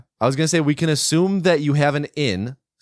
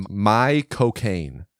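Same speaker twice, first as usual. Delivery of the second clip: slow drawled Cockney "My cocaine"; Michael Caine voice trick; 4 semitones lower, much slower than usual. Shown as a demonstration. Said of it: "the best way to do his voice is to say".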